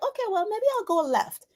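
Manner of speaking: high, quick, light mock concession; tentative giving-in. Acted grudging agreement with inner voice.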